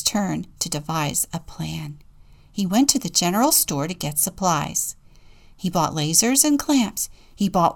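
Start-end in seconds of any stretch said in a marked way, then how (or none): none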